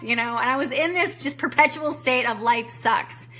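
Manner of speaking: normal talking voice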